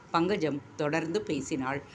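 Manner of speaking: usual speaking voice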